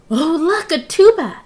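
loud, high burst of delight; excited. Acted. Agent Lion spotting a tuba in the nursery, loud and thrilled.

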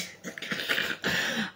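breathless, voiceless burst of laughter; delighted laugh. Real, not acted. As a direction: laugh out of sheer joy. Overjoyed at the gifts.

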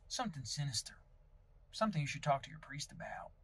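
soft, ominous Southern near-whisper; hushed mock-grave insinuation; sinister hush. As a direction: drop the volume as if hinting at a dark secret. Hinting darkly at listener's sin.

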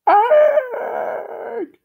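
very high, loud squeal; piercing high cartoon squeal; girl's squeal. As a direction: shriek the word like a little girl. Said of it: "Girl two".

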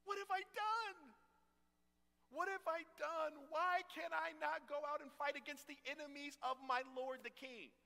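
a desperate soldier pleading with the king to fight; begging David. Acted. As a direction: whine it like you're being benched. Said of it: "he is begging to fight".

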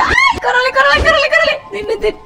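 high, frantic yelling; panicked shouting. Real, not acted. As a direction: shriek at friends to run. Fleeing the captor in game.

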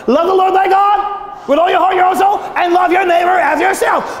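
high, driving Scripture recital; preaching. Real, not acted. Pastor reciting the commandments to the congregation, high impassioned preaching. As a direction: preach it out in long rising phrases.

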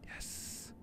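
very soft, unvoiced-sounding whisper; excited whisper. Real, not acted. Player watching a build in suspense, whispering with excitement. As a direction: whisper it as if holding back excitement. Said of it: "I don't know why I'm whispering".

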